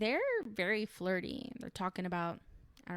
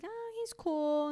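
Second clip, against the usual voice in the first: high, drawn-out noncommittal reply; mock casual brush-off; 10 semitones higher, 4 dB louder than usual. Raya answering whether she likes Brian, mock casual. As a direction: imitate playing it cool, pitched up.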